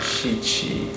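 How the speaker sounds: quiet, gentle instructions; soft breathing cues. Real, not acted. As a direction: guide the breath softly. Doctor guiding breathing softly.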